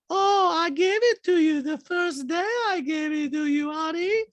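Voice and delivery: very high, drawn-out catty impression; Diana. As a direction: taunt sweetly in an exaggerated slow falsetto. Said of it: "And Diana goes".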